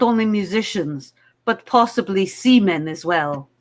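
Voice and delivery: put-on heavy Russian accent. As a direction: play it as a Russian contestant. In Russia, we say damar.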